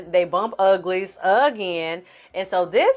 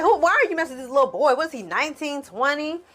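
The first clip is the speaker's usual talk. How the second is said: fast, higher mock disbelief; mocking confrontation; 6 semitones higher, faster than usual. [name] sneering at Nandi about Jacob, fast high mock disbelief. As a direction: rattle it off, sneering at the boy.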